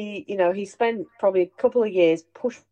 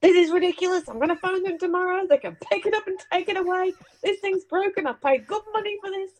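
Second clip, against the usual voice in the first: high, indignant ranting; mock furious Dad; 12 semitones higher than usual. Dad ranting that the telly is broken, hammed-up fury. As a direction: fume about the broken telly in a put-on voice. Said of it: "he's going".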